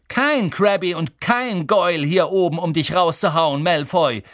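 calling out. Acted. Harry taunting Malfoy mid-air, loud. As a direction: taunt him across the air. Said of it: "rief Harry".

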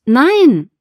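high, loud cry of refusal; loud protest. Acted. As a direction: shout it out to stop him. Patient protests as he reaches for button.

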